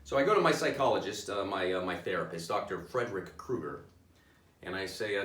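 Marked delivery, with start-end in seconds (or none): none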